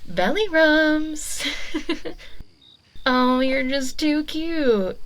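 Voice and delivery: high, loud, slow cutesy squeal with a giggle; gushing baby talk. Acted. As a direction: squeal over an adorable pet. Witch gushes over her cat.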